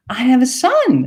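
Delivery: high, falling proud announcement; high proud old lady. Retold or imitated. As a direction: announce it like a proud elderly mother. Woman's voice retold.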